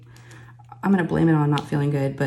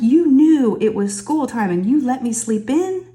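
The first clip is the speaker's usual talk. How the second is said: mock outrage; 7 semitones higher, 5 dB louder than usual. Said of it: "I'm like".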